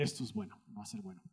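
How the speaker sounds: soft whispered echo. Acted. Interpreter whispering the preacher's teaser. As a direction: whisper it back, conspiratorial.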